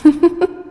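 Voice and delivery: short, haughty villain's laugh; gloating goddess laugh. Acted. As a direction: laugh down at the puny mortals. Goddess gloats over terrified men.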